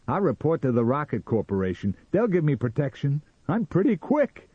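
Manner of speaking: high, loud, fired-up bluster; loud boasting. A narrator's reading. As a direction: brag loudly, voice climbing. Sam boasting after the chase.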